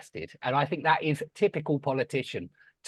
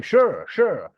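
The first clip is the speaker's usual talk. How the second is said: "Sure" as Irish one-syllable shower, louder; imitated Irish accent; 3 semitones higher, 7 dB louder than usual. Irish dad repeating the word to baffled guest, imitated Irish accent. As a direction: mimic an Irishman insisting on "sure". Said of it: "he kept saying".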